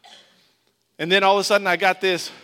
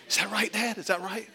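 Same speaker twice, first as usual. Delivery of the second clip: put-on unsure kid; 5 semitones higher, 4 dB quieter than usual. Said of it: "I'm like".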